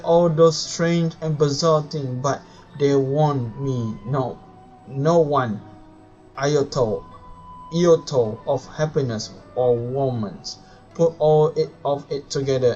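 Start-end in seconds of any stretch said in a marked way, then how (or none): none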